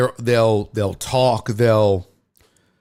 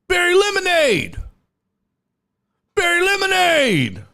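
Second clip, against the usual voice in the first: heckler's yell. Retold or imitated. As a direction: imitate a heckler hollering a name, pitched way up. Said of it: "I can hear him yelling".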